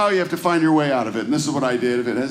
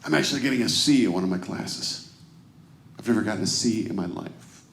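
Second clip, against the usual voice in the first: low, soft, downcast voice; imitated distraught son; 7 semitones lower, 6 dB quieter than usual. College son calling home about his first C, quietly upset. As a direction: imitate a crushed student confessing quietly. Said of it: "I can hear it in his voice".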